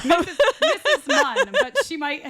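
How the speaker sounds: giggling through the name, high; laughing. Real, not acted. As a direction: laugh as you say it. Laughing over the nickname.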